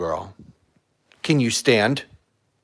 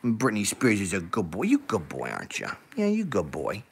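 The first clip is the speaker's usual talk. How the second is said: cooing; 8 semitones lower, 10 dB quieter than usual. Real, not acted. Owner cooing softly to her calm dog.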